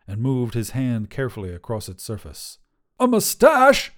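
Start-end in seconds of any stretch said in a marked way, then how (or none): 3.0-3.9 high, loud, incredulous squawk; outraged shout. A narrator's reading. Wizard getting a painted mustache, shouting in outrage. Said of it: "Grandois shouted".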